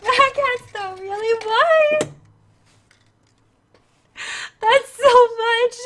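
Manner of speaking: real overwhelmed tearful voice; crying. Real, not acted. Streamer overwhelmed by a viewer's big donation, crying. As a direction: break into grateful sobs as you speak. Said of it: "I'm just gonna be crying on stream".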